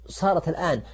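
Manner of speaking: ordinary delivery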